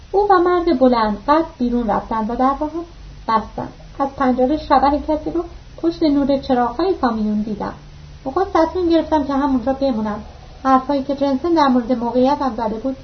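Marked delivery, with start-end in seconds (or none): none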